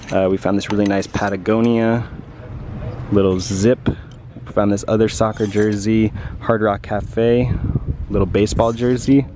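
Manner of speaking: usual speaking voice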